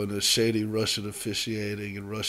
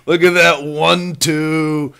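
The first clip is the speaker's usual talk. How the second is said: slow, louder, rising to a high cheer; milk every punch like a fanboy commentator; hyped announcer; 5 semitones higher, 12 dB louder, much slower than usual. Retold or imitated. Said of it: "they're like".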